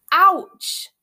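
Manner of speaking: loud, high yelp of pain; sudden pained shout; bellowed. A narrator's reading. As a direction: bellow as if jabbed by a pin. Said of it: "bellowed Malfoy".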